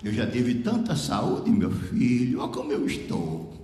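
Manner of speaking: low, slow, weary lament; frail sick woman. Retold or imitated. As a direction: voice a failing old woman, drawing words out. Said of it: "ela começou a dizer".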